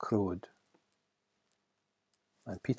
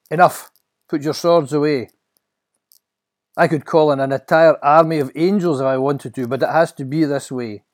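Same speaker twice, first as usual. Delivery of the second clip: loud, raised, firm command; commanding Jesus; 5 semitones higher, 12 dB louder than usual. Acted. Jesus to his friend who drew a sword, commanding. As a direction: halt the fight with authority.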